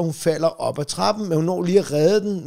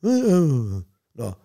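ordinary talk

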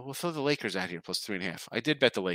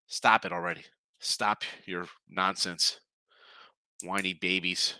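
ordinary delivery

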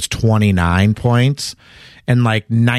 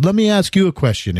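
lightly imitated Greek accent, pitched higher; a hint of a Greek accent, pitched up; imitating a Greek basketball star's accent, pitched higher; 10 semitones higher than usual. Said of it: "in his Greek accent".

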